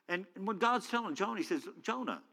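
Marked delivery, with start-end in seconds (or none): 1.8-2.2 soft, higher-pitched calling of a name; gentle reproof. Acted. God speaks to angry Jonah, gently reproving. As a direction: say the name softly to calm him.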